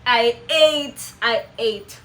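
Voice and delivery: loud, emphatic boast; mock self-congratulation. Privately celebrating her American accent impression, playfully boastful. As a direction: hype yourself up in your head.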